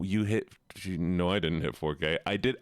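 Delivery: ordinary talk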